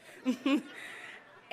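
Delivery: light breathy laugh after the quote; real amused laugh. Real, not acted. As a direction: let a fond laugh slip out.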